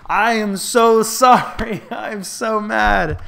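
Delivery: high, loud, slow wail broken by a laugh; laughing mock rage. Real, not acted. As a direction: stretch every word out in exasperated half-laughing fury. Fuming after throwing the match.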